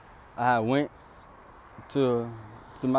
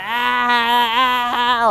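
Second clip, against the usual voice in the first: mocking laughter; 10 semitones higher, 5 dB louder, much slower than usual. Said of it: "she was like".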